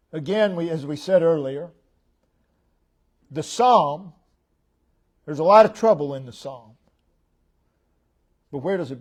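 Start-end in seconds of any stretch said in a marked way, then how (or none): none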